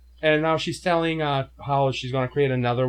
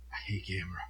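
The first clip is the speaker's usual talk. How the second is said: hushed whispered confession; 5 semitones lower, 14 dB quieter, faster than usual. Real, not acted. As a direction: whisper it like a secret. Confessing hatred.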